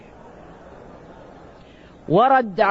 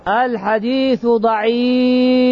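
الحديث ضعيف spelled out very slowly; slow verdict; 2 semitones higher, much slower than usual. Shown as a demonstration. Preacher rules on the hadith for his listeners, drawing out الحديث ضعيف. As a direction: weigh each word of الحديث ضعيف.

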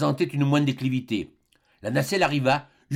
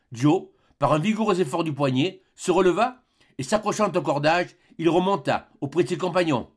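ordinary talk, nothing marked

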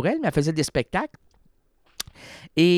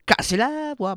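cracked voice; 11 semitones higher than usual. Shown as a demonstration. Host showing how the French say it, cracked high. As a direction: voice jumps high and cracks on Casser la voix. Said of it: "en France, on dit casser la voix".

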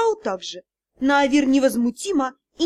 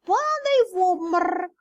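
cat spirit voice; 5 semitones higher than usual. Acted. Cat advises.

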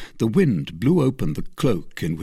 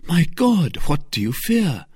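higher, louder startled question; alarmed cry; 10 semitones higher, 3 dB louder than usual. Acted. D'Artagnan questioning Athos, alarmed. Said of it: "cried D'Artagnan".

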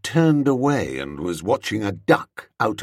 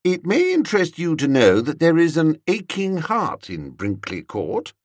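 higher-pitched, firm announcement; 8 semitones higher than usual. A narrator's reading. Telling her of an aching heart, firm. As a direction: state it firmly and pointedly. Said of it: "I said firmly".

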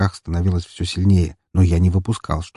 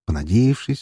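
ordinary delivery, nothing marked

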